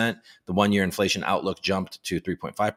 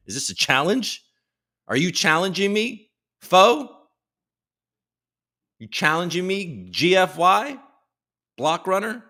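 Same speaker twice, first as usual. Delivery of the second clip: higher, louder mock-tough taunting; mock challenge; 10 semitones higher, 4 dB louder than usual. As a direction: puff up and jokingly call them out. Mock challenge to an NFT collection.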